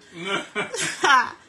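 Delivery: helpless real laugh; real laughter. Real, not acted. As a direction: laugh it out, can't hold it in. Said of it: "I can't even say it without laughing".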